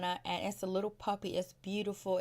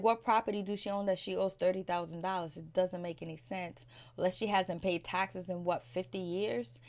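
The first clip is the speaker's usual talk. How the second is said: usual speaking voice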